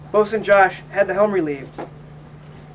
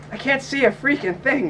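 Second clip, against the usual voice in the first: lightly voiced smoky woman, pitched up; a hint of smoke in a higher, lilting voice; 6 semitones higher than usual. A narrator's reading. New crewwoman complains to the narrator at the helm, lightly smoky voice. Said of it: "she said in her smoky voice".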